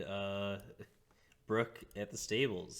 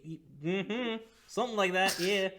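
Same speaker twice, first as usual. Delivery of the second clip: high put-on innkeeper voice; 11 semitones higher, 5 dB louder, slower than usual.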